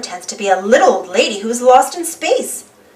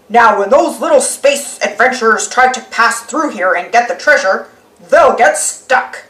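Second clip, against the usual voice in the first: lightly voiced scheming pirate; a hint of villain glee, slowing at the end; Pirate Pete. Pete plotting his trap, mildly sly. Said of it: "says Pete".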